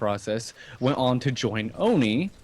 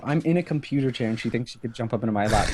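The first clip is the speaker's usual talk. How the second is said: ordinary talk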